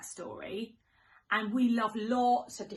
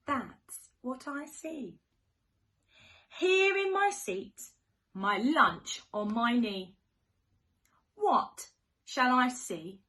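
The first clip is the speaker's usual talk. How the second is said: sing-song storybook rhyme; reading to children; 5 dB louder, slower than usual. Acted. Teacher reading to little ones, sing-song and playful. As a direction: read it like a bedtime picture book. Reads rhyming picture book.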